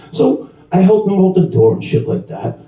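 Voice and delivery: ordinary talk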